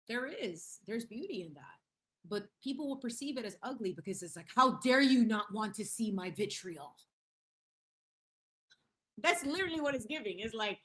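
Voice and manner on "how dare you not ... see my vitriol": louder, hammed-up outrage; mock indignation. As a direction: imitate an offended troll. Mimics offended blocked troll.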